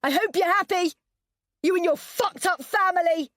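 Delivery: high, loud, bitter yell; furious yell. A narrator's reading. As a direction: hurl it after him. Yelling after husband.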